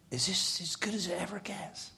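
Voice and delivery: high, hushed wonder; awed whisper. Retold or imitated. Player thinking after manager's praise, whispering in wonder. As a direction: whisper it to yourself in awe. Retold awed inner thought.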